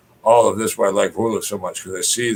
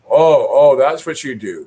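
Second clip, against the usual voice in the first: higher, louder imitated busybody gasp of "oh"; mock judgmental; 5 semitones higher, 6 dB louder, slower than usual. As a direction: pounce on it like a nosy judge. Mimics people passing judgment.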